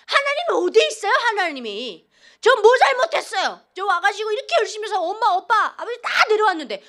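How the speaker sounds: loud, high, indignant protest at God; retold angry outburst. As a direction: shout it up at God, half laughing in disbelief. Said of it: "막 이랬는데".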